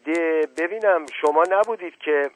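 high, loud accusing cry; sudden shout. Acted. Old woman shouts at the silent guest, loud accusing cry. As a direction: yell it out suddenly as if catching him. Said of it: "ناگهان فریاد زد".

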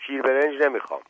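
lightly voiced child crying out in refusal; a touch of a shouted protest; child's protesting yell. A narrator's reading. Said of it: "و داد زد".

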